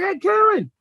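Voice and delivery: high-pitched call to someone upstairs; retold yelling out. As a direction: re-enact calling up the stairwell to her. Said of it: "I'm yelling out".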